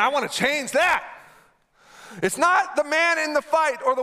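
rally the congregation; preaching at full force. Acted.

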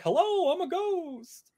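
very high, wailing mock ghost. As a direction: hoot it like a silly spook. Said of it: "the dudes who are like".

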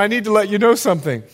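normal talking voice

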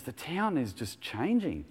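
café lady retelling the change, amazed. Retold or imitated. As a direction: imitate the lady marvelling at the town. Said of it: "She goes".

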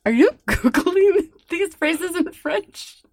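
high, slow laughing question; amused teasing through laughter. Real, not acted.

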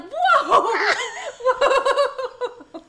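very high, loud comic alarm; hammed-up yell to stop someone, laughing; shocked whoa. Vet staff stopping owner undressing, mock-shocked shout. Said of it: "And we were like".

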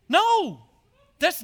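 loud high cry of No; forceful no. Real, not acted. Preacher rejects that image of God, loud emphatic no. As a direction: cut the idea off hard.

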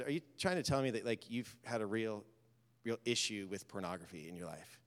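soft and low, easing into it; gentle quiet question. Retold or imitated. Pastor quietly asks the struggling man, softly probing. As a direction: ask gently, near a murmur.

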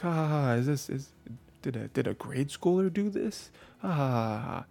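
mocking laugh. Rude couple at the zoo sneers at her drawing, mocking laugh. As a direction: ham up a snooty jeer.

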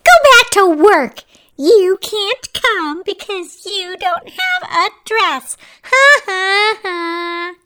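high, loud, singsong taunting with mocking laughter; nasty teasing. A narrator's reading. Stepsisters mocking Cinderella as they leave, nasty teasing. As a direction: jeer like mean stepsisters, shrieking high. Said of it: "teased Cinderella as they were leaving".

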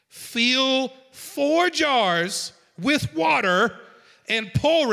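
slow, drawn-out loud command; commanding Elijah. Acted. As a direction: give the order like a prophet.

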